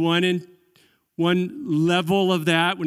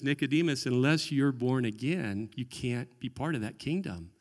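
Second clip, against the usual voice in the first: quietly quoting Jesus; 4 semitones lower, 8 dB quieter than usual. Retold or imitated. Jesus quoted speaking to Nicodemus.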